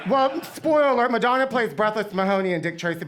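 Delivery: ordinary delivery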